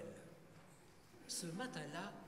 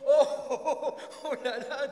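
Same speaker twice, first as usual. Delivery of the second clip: very loud, high exclamation; overwhelmed outcry; 9 semitones higher, 18 dB louder than usual. Acted. Nathan swamped by the shepherd's list, loud dismay. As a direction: burst in to halt the flood of names.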